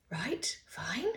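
unvoiced whisper of reluctant agreement; half-whispered, awkwardly going along with it; whispered to herself. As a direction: breathe the words out under your breath. Her unsure inner reaction.

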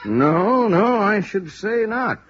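high, slow and emphatic no; emphatic denial. Acted. Lieutenant insists to the investigator the case is open, emphatic. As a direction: brush the idea off firmly, drawn out.

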